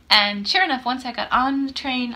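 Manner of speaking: usual speaking voice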